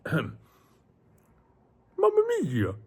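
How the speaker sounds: cartoon Italian accent, leaping high and loud; Mario, Italian voice. Shown as a demonstration. Streamer answering a chat request, exaggerated Italian voice. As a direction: exclaim in a hammed-up Italian accent. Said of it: "can I do an Italian voice?".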